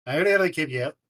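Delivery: loud, hammed-up bad Australian accent; a clumsy Australian accent, hammed up; awful Australian. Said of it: "awful Australian".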